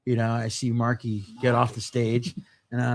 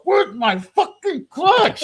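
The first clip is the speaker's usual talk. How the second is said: high, loud, drawn-out yell; acted outburst, stretched and screamed out; furious yelled demand; 8 dB louder, much slower than usual. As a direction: blow up, hammering each word slowly. Escalated repeat of the demand.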